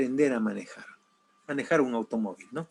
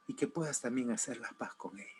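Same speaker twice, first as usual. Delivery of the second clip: fast, whispered plea to his father; a son whispering his last request to his father; 3 semitones lower, 13 dB quieter, faster than usual. Retold or imitated. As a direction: breathe out the end of the plea.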